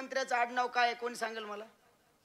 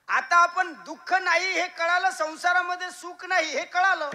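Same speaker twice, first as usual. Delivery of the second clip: preaching emphasis; 5 semitones higher, 8 dB louder than usual. Real, not acted. Preacher driving home his point.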